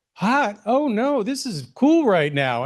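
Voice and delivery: lightly mock a breezy Texas drawl brushing off the heat. Texas bank woman laughing off the heat, light imitated Texas drawl. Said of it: "a just beautiful Texas drawl".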